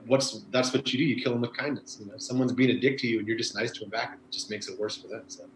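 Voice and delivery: usual speaking voice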